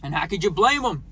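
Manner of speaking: normal talking voice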